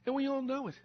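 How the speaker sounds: ordinary talk, nothing marked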